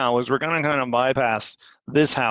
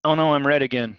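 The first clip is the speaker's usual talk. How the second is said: ordinary talk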